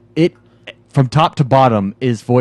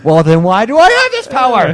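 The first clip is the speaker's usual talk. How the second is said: higher, louder mock outburst; mock protest; 9 semitones higher, 5 dB louder than usual. Player talking back to a game guard, mock indignant protest. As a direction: protest in comic exasperation, pitched way up.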